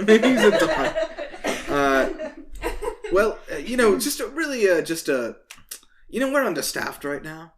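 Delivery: flustered guard stumbling over an excuse; lightly voiced town guard. Guard explaining they are understaffed, mildly flustered. Game master resumes guard voice.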